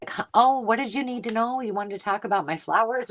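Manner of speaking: put-on high, puzzled old lady voice; confused wife. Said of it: "and she's like".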